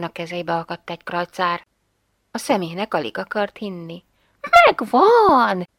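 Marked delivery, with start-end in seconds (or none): 4.7-5.7 higher, louder joyful cry; excited shout. A narrator's reading. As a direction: cry out in delight at the find. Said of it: "kiáltotta". (örömteli, izgatott felkiáltás)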